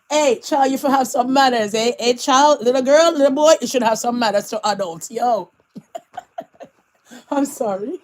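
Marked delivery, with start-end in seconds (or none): none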